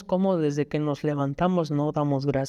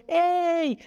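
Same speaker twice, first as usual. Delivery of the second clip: retold shout at the bus, much higher; raised, high call after a bus; 11 semitones higher than usual. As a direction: yell to stop the bus. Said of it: "Le estoy gritando".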